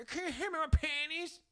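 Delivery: high put-on woman's voice; upstairs neighbour lady. Man imitating neighbour who dropped laundry, high lady voice. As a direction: imitate her in a high pitch. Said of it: "she was like".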